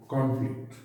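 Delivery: Conflict with the weight on "kon"; stress first syllable. Shown as a demonstration. Teacher showing the noun stress on conflict. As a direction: lean on the first part of conflict. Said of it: "capital letters में पहला हिस्सा लिखा है".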